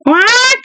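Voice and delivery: high-pitched imitated squawk; sudden shrill bird call; startled bird cry. A narrator's reading. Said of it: "one startled bird cry".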